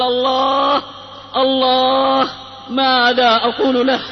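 retold desperate cry. Dying friend answering who he means, restrained cry. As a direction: voice the friend's anguish on long held words. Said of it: "يصرخ بأعلى صوته".

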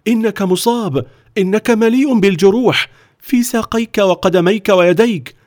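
high-pitched alarm; horrified, voice pitched right up; panicked. A narrator's reading. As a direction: cry out in panic at someone's injuries. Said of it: "وقال ليو في هلع".

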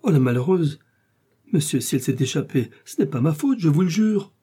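exclaiming, anxious prisoner; distressed Bonacieux. A narrator's reading. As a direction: plead innocence with mounting urgency. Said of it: "s'écria Bonacieux".

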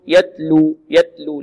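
"يتلو" mispronounced with the ت stopped dead, no puff of air; breathless ت. Shown as a demonstration. Teacher shows students the opposite mistake, breathless ت in "يتلو". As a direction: cut off all breath on the ت in "يتلو". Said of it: "وكذلك لا نعدم الهمس".

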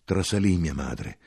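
ordinary delivery

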